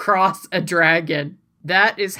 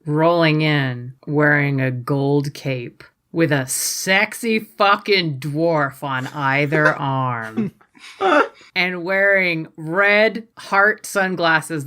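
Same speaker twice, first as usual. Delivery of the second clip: slow dramatic; slower than usual. Acted. Dramatic slow entrance description.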